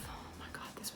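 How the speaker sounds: hushed, breathless whisper; whispered aside. Real, not acted. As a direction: whisper it like a secret to the camera. Aside about the funny moment.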